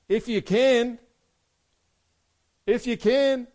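high, loud repeated question; incredulous echo. Acted. Jesus to the father, echoing his doubt, high and loud. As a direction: throw the doubt straight back at him.

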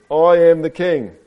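slow, high, loud proclamation; storybook ape crowing; gleeful shriek. A narrator's reading. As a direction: shout it as you run off. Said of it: "Ape ran off shrieking".

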